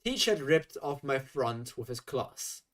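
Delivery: usual speaking voice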